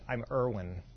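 low, soft, flat mumble of a name; bored and unenthused. Retold or imitated. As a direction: say it like you'd rather be anywhere else. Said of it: "And he goes".

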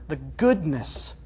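"goodness" drawn out very slowly, first syllable leaned on; stress on goodness. Real, not acted. Preacher repeating the key word to his congregation, stressing "goodness". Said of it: "and I stress that word".

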